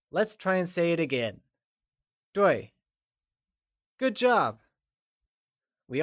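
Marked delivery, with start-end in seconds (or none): 2.3-2.7 a firm falling pitch on "Dui". Shown as a demonstration. Teacher asking learners to repeat, "Dui" in a falling tone. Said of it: "is pronounced using a falling tone".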